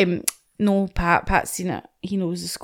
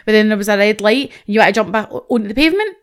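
normal talking voice

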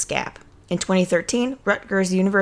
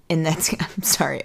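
laughing under breath while talking; trying hard not to laugh; stifled giggles; 3 dB quieter than usual. Real, not acted. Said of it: "I'm trying not to laugh".

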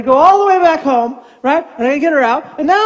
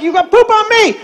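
high-pitched mock-indignant grandpa; exasperated comic comeback; grandpa's retort; 9 semitones higher, 3 dB louder than usual. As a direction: throw a child's accusation back, pitched up. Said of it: "I'm like".

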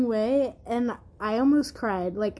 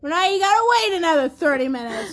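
scolding; 5 semitones higher, 6 dB louder than usual. Real, not acted. Scolding the hungry friend.

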